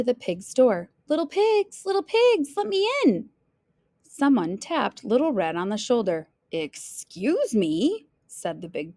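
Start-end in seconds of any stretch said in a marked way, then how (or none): 1.1-3.3 high singsong call at the door. Acted. Little Red calls to the pigs next door, singsong. 6.5-8.1 slow, stretched-out interjection; pointed interruption. Acted. Big bad wolf taps Little Red's shoulder, drawn-out interruption.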